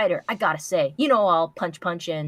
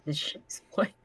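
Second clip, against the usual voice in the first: normal talking voice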